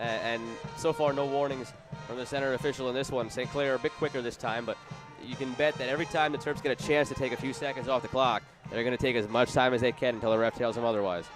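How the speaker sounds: ordinary delivery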